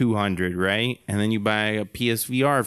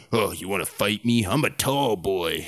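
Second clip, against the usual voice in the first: guy in voice chat; 3 semitones lower than usual. Retold or imitated. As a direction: play a goofy tough guy. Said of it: "be like".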